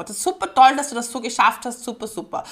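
ordinary delivery

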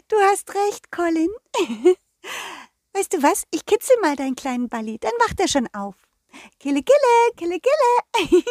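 tiny, very high giggling fairy. Acted. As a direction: tickle him in a high fairy voice. Fairy character voice.

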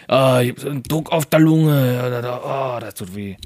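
mock ailing celebrity, fading off; Mario Basler. Imitating a reality star with lung trouble, mock suffering. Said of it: "Hat er mit der Lunge gehabt".

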